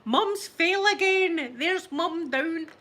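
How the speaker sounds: loud, high, drawn-out holler through the house; mock yell, stretched out slowly and pitched high; imitated shouting. Daughter calling out that mum has fallen, shouting. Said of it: "she'd be shouting".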